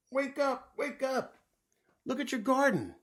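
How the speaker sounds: very high, loud calling; excited wake-up call. A narrator's reading. Waking Toad to see his garden, high and excited. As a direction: rouse a sleeper with big news. Waking sleeper with urgent news.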